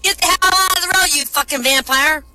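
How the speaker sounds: high, loud, fast furious shout; angry yell. Acted. Nun to the vampire in the road, fast furious yell. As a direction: scream him off the road.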